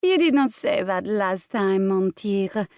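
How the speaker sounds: put-on fawning, teasing French accent. Celeste coaxing Bram, fawning French accent. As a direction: purr it in a coy French accent. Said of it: "the teasing French accent fawned".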